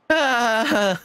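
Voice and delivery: high, timid character voice; high put-on impression, losing nerve; Kachina. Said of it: "put on my best Kachina impression".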